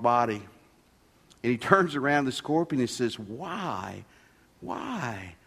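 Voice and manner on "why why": much softer, lower, wounded frog; hurt, quiet question. Acted. Poisoned frog turning to the scorpion mid-river, quietly hurt. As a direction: drop to a soft, dying plea.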